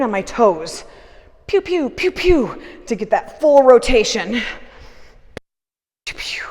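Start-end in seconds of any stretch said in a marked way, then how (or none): 1.5-2.6 high, loud laser-gun pews; pew pew sound effect. Pretend. Mimics toy blaster while pivoting. 6.0-6.4 whispered laser-gun noises, soft; whispered pew pew. Pretend. Repeats blaster noise softly.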